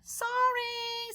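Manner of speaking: high, small apologetic voice; high sheepish kid. Acted. Clark apologizing to friends at recess, high and sheepish. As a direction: say sorry like an embarrassed kid.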